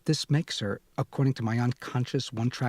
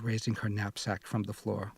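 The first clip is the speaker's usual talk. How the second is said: usual speaking voice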